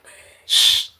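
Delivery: whispered shush into the phone; hushing whisper. Retold or imitated. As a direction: hush her quietly, phone hidden.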